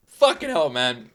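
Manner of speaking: ordinary delivery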